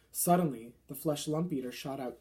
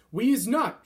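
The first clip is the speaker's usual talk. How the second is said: a loud, higher chorus shout; 7 semitones higher, 10 dB louder than usual. A narrator's reading. Giants agreeing with their leader, shouting. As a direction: roar it back together. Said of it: "shouted the other giants".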